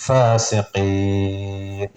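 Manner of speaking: low chanted "ഫാസിഖീൻ" with a long held ഖീ; stretched final vowel. Shown as a demonstration. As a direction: stop on "ഫാസിഖീൻ" by drawing out the last vowel. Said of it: "നീട്ടിയിട്ടാണ് നിർത്തേണ്ടത്".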